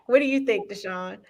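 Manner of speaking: usual speaking voice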